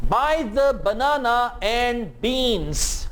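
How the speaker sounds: Buy the banana and beans drawn out very slowly; slow teacher demo; slow model sentence. Shown as a demonstration. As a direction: stretch the b sounds for learners. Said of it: "इन sentences को मेरे साथ-साथ repeat करें".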